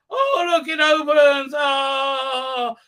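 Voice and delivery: high, loud exclamation of awe; mock wonder. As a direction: marvel loudly in hammed-up amazement. Acts awe at lands opening.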